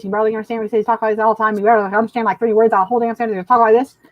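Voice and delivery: rapid run-on cartoon mumble; imitating a fast-talking Southern cartoon man; Boomhauer impression. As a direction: rattle the words out with barely understandable articulation.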